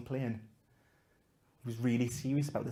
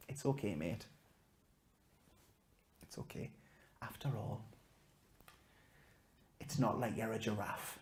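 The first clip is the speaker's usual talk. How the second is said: put-on hushed child wisdom; 3 semitones lower, 11 dB quieter than usual. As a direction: deliver it like a tender secret. Boy's whispered final wisdom.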